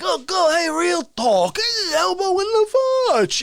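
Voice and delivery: high, strained villain voice; put-on pest butting in; pestering villain. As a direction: taunt in a loud, high goblin-like voice. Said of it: "he's always like".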